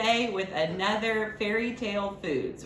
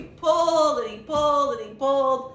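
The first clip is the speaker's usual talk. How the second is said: effortful, louder storytelling strain, building down; straining pull; 5 semitones higher, 6 dB louder than usual. Acted. Librarian acting the man straining at the turnip, effortful. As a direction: act out heaving on the turnip.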